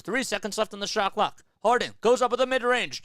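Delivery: building from calm call to loud, high, fast excitement; live play-by-play. Acted. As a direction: call the final seconds like a broadcaster.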